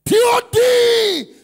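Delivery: long, high, slow shouted letters. Acted. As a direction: belt out each letter to the crowd. Preacher shouting out a name.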